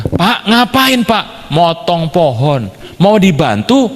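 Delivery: high, drawn-out friendly hail; imitated neighbour calling over. Neighbour offers help with the tree, high calling imitation. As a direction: call across to the man next door.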